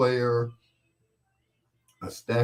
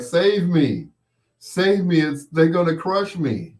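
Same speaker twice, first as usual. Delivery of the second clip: high, pleading little voice; pleading computer; 7 semitones higher, 4 dB louder than usual. Pretend. Computer begging the speaker to save it, high plea. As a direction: beg to be saved from the crusher. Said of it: "It was like".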